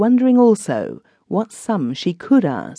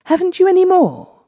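much higher, eager pleading; greedy for more; 4 dB louder than usual. A narrator's reading. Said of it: "She kept asking".

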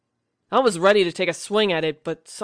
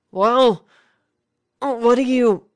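forcing the voice louder and higher, straining; raised strained voice; 6 semitones higher, 5 dB louder, slower than usual. Acted. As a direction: push out the words as if lungs are failing. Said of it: "I tried to raise my voice".

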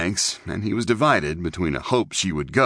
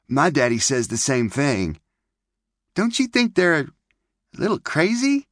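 put-on high, bright little-girl chatter; girlish May voice; 9 semitones higher than usual. Young girl May chattering to Clint by the camp. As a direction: play the pert pioneer girl.

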